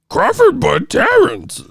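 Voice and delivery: high, drawn-out mock creature voice; Yoda. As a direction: read it slowly like a baffled alien sage. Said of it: "Dyslexic Yoda sounded that".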